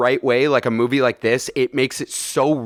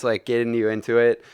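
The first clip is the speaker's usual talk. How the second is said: ordinary talk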